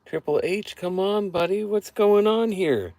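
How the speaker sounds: high, pleading exasperation, slowing at the end; exasperated plea. Retold or imitated. Fan pleads with Triple H about the booking, high and exasperated. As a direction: beg the boss in high disbelief. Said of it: "I was just like".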